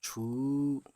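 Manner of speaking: छू with a long drawn ू. Shown as a demonstration. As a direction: stretch the छू long and slow. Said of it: "तब ये खींच के बोलेंगे हम".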